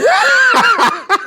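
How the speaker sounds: shocked what. Retold or imitated. Retold shock at queen's arrival.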